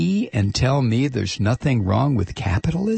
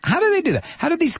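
pitched way up in disbelief; exasperated high outburst; 13 semitones higher, much faster than usual. Real, not acted. Ranting host rails at corporations in disbelief. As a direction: cry out the question, incredulous. Exasperated rant repeating question.

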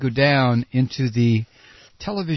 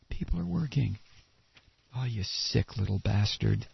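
much softer, lower, disgusted; disgusted hush; 6 semitones lower, 10 dB quieter than usual. Real, not acted. Comedian reacting to Bush's jobs claim, hushed disgust. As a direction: mutter it in revulsion.

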